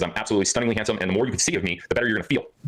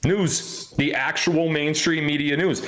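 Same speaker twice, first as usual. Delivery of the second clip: mock announcer; 6 semitones higher, much slower than usual. Mocking the mainstream media.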